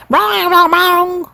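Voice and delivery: high, ringing run of mock piano clangs. A narrator's reading. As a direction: bang them out like keys hit in a row. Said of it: "went the piano".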